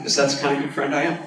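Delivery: usual speaking voice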